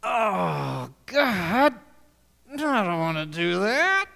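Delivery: crying whine, pitch jumping up on God; whiny reluctant believer. Retold or imitated. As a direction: whimper like a kid refusing. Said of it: "Are you like that?".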